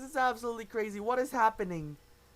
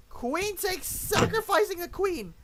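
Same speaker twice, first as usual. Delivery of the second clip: high-pitched, excited outburst; astonished; 10 semitones higher, 4 dB louder than usual. Real, not acted. Commentator sees queen sacrificed live, astonished. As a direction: call it out in rising disbelief. Reacts to a shocking chess move.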